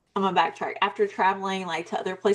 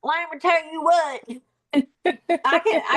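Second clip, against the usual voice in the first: put-on Southern accent; 8 semitones higher, 3 dB louder than usual. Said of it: "And then other people are like".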